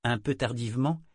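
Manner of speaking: usual speaking voice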